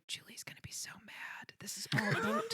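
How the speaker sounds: unvoiced hushed whisper. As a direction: whisper it to yourself. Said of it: "I kept going".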